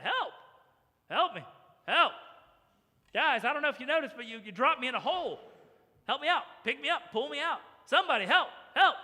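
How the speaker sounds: loud, high calls from the bottom of a hole; yelling for help. Acted. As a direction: cry out to be rescued by those above. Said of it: "above him yelling out".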